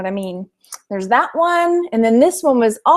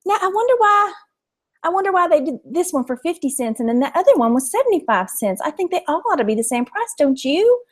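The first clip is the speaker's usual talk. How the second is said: put-on high, sweet Southern lady voice with a thick East Texas accent; 6 semitones higher than usual. Vlogger voicing the shop lady over the watches, high sweet East Texas accent. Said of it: "I'll do the rest of the watches in her voice".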